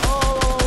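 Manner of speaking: loud, high startled scream. Real, not acted. Player jumped by an enemy in game, startled scream. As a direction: scream out in sudden fright. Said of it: "que me has espantado".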